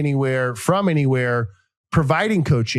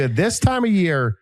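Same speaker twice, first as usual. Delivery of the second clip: ordinary talk, nothing marked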